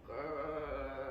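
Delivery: low rumbling grrr; low growl. A narrator's reading. Storyteller making the mystery sound a low growl. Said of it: "It went".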